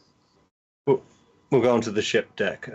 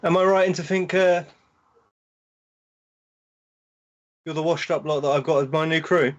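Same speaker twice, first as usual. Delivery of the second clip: raised pitch, bold captain addressing new crew; 8 semitones higher than usual. Acted. Captain greeting his new crew, confident. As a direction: play the swaggering captain sizing them up.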